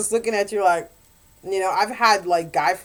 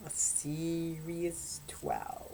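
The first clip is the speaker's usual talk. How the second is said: much softer and very slow; sultry hushed emphasis; 3 semitones lower, 16 dB quieter, much slower than usual. Shown as a demonstration. Rating a hot romance, hushed and very slow. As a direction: drop to a hushed, drawn-out verdict. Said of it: "put this on a sizzle meter".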